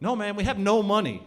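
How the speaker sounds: insistent protest. Retold or imitated. Acted protest at money demand.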